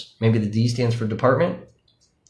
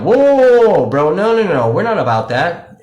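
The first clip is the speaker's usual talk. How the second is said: high, loud alarmed calling out, calming down by the end; startled shout to stop a protester, settling as it goes; alarmed whoa; 7 semitones higher, 8 dB louder than usual. Retold or imitated. Retelling the stop of a protester cursing beside them, loud and alarmed. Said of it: "and went".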